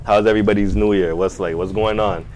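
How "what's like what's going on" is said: normal talking voice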